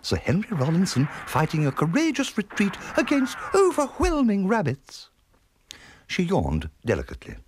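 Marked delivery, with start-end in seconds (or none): 0.0-5.1 high, genteel aunt voice; trilling. Acted. Unruffled aunt commenting on the rifle fire, trilling. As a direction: trill it out grandly, high-pitched. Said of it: "trilled Florrie".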